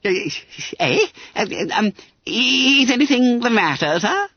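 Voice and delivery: high squeaky bewildered schoolboy; stammered. A narrator's reading. Baffled Bunter questions his angry master, stammering. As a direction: stammer nervously in a high-pitched voice. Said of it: "stammered Bunter".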